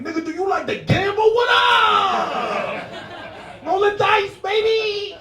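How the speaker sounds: over-the-top casino commercial hype; hammed-up gamble ad yell; hyped gambling ad. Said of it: "next commercial come on and be like".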